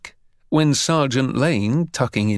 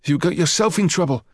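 higher-pitched schoolboy protest; 6 semitones higher, faster than usual. A narrator's reading. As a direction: protest back at the bully. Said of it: "Harry protested".

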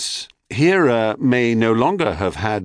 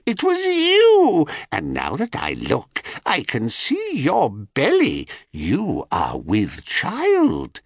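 high, slow gloating crone; sly old hag, savouring it; 8 semitones higher, slower than usual. A narrator's reading. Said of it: "screeched the crone".

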